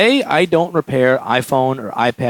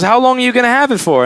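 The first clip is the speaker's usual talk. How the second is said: high-pitched imitation of an anxious customer; mock frantic questioning, pitch pushed up; 9 semitones higher, 3 dB louder than usual. Customer asking how long he will have her phone, mock frantic. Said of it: "she's really frantic".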